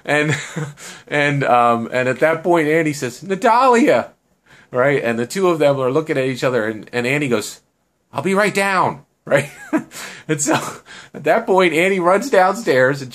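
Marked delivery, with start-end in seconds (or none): 3.2-4.1 high, loud call of a name; excited call back. Acted. Annie answers Natalia at the window, high loud call. 8.1-9.0 high, raised call to someone below. Acted. Telling a friend at a distance, high raised call. Calling down from window.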